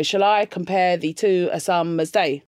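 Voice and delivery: "Shall I compare thee to a summer's day?" slowly, every second syllable stressed; iambic stress. Shown as a demonstration. Poet showing the rhythm of Shakespeare's iambic line, slow iambic stress on "Shall I compare thee to a summer's day?". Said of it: "in iambic".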